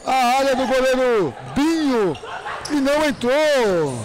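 fast, excited match commentary; play-by-play commentary. Real, not acted. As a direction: call the game live. Live futsal play-by-play.

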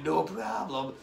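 usual speaking voice

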